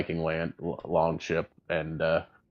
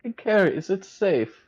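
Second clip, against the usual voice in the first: high character voice; 8 semitones higher than usual. Acted. Edmund calling out to Carrie, high and bright. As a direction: call out cheerfully.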